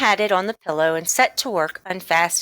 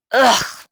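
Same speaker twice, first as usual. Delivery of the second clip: louder disgusted ugh; sputtered ugh; 5 semitones higher, 6 dB louder than usual. Acted. Boy tasting horrible tonic, sputtering in disgust. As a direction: spit out a gross-tasting gasp. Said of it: "John sputtered".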